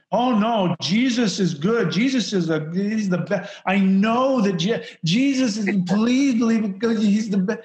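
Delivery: hammed-up desperate devotee. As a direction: beg them to believe it.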